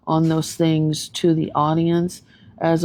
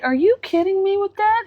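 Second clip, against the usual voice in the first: much higher, almost cartoonish disbelief; incredulous squeal. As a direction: ask it like you truly can't believe your ears. Reacting to the show.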